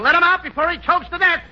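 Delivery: normal talking voice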